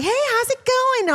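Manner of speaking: very high, sing-song hello; high cheery greeting to inmate; mock chatty greeting. Nurse chatting with inmates, high and sing-song.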